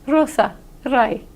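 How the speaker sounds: Rosa, rai with the R flicked off the upper palate; tapped R. Shown as a demonstration. Teacher demonstrating a Spanish tapped R in Rosa, rai. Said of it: "your R bounces off the upper palate".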